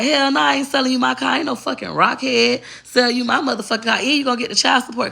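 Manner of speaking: hamming up his indignant no; mock angry refusal. Retelling boyfriend refusing her.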